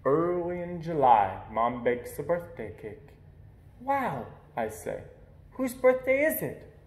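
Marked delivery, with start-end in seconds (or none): 3.8-6.7 surprised. A narrator's reading. Boy surprised by a cake.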